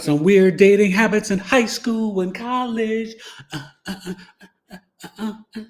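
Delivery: high, loud mock teasing. Real, not acted. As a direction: rib the viewer playfully. Teases chatter about dating habits.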